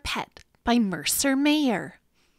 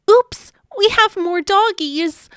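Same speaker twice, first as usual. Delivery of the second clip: excited announcement; 7 semitones higher, 7 dB louder than usual. Acted. Announcing the new puppies.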